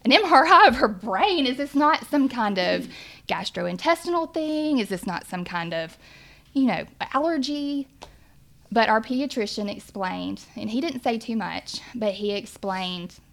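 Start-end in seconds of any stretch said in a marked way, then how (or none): 0.0-7.9 loud, higher, stunned questioning; incredulous disbelief. Retold or imitated. Parents questioning the pediatrician's brain scan plan, loud incredulous disbelief. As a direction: voice jumps up in shocked disbelief at the news. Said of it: "Brad and I were like".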